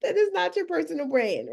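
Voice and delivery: high, amused mock scolding; incredulous retort. Coach retells correcting the client, high amused disbelief. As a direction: scold her with a laugh in the voice. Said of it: "It's like".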